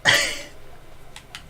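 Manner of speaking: high breathless giggle; a tiny airy giggle; squeaky giggle. Real, not acted. Giggles before a treasure cheer.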